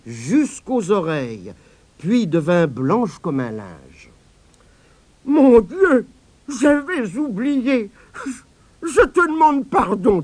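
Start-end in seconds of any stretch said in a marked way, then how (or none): 5.2-10.2 put-on high, slow, crying old woman's apology; tearful apology. A narrator's reading. Grandmother begging the boy's pardon, tearful. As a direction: break down as you beg forgiveness.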